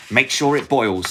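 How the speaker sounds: high, loud witch order; witch command. Acted.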